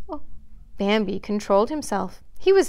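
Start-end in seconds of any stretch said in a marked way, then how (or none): 0.1-0.2 soft, breath-only gasp and oh; involuntary frightened cry. A narrator's reading. Faline catches sight of the gigantic stag, startled gasp. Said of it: "uttered an involuntary cry".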